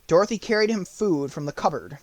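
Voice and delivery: usual speaking voice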